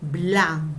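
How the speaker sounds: Blan said alone, stretched and firm; stressed syllable. Shown as a demonstration. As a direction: isolate Blan as the accented syllable. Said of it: "¿dónde está el acento primero?".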